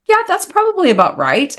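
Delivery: high, knowing, deadpan-sarcastic reply; sarcastic retort. Retold or imitated. Retelling her dry reply to the puzzled nurse, sarcastic. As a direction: toss it off like it's obvious.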